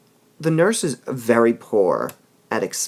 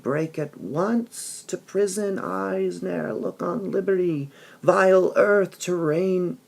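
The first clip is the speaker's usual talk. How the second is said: slow, higher, mildly grieving Juliet; Juliet mourning; 6 semitones higher, slower than usual. Retold or imitated. Teacher reads Juliet grieving for Romeo, slow lament. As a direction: draw out a young girl's despair, understated.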